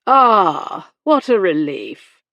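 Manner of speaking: loud, exaggerated sigh of relief; patronizing. A narrator's reading. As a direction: feign relief with a condescending smile. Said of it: "with a patronizing smile".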